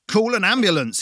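frantic shout to the staff; shouting for help. Acted. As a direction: yell across the shop in panic. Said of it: "he shouted".

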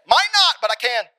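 high, loud burst of comic confidence; encouraged shout. Acted. Player to self after airballing the shot, excited shout.

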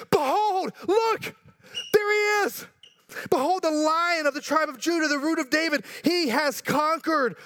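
high, ringing "Behold", proclaimed; exclaiming proclamation. Shown as a demonstration. Preacher shows the elder's cry, high and exclaiming. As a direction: announce it like a herald. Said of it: "What does he say?".

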